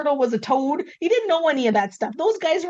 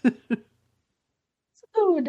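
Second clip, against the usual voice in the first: ordinary talk, nothing marked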